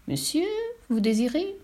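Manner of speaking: inquire in a lifted, acted voice; pope at the door. A narrator's reading.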